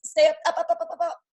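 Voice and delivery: pushing them to hold on; rising coaxing chant of up; urging up, up. Real, not acted. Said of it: "coach urging hold of pose".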